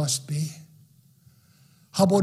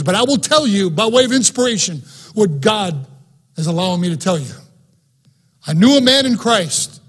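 louder, full-force pulpit reading; preaching; 5 dB louder than usual. Acted. Pastor reading Paul to his congregation, full-force preaching. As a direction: proclaim scripture to the congregation. Preacher reads Paul's words aloud.

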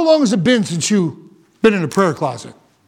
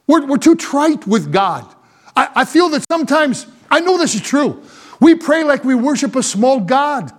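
ordinary talk

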